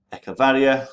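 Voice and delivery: Echevarria in a Spanish accent, stressed on the ri. Shown as a demonstration. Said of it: "sorry for my Spanish accent".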